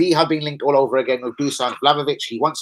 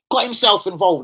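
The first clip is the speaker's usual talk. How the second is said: ordinary delivery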